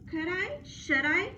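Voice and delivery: tempted but unsure; doubtful dog. Acted. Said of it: "he thought".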